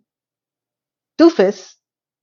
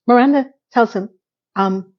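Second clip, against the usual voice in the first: ordinary talk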